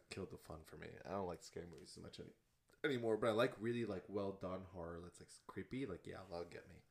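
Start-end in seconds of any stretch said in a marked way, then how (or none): none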